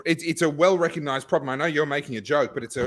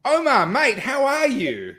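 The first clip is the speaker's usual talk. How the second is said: excited greeting; 12 semitones higher, 5 dB louder, slower than usual. Real, not acted. Greeting guest loudly on show.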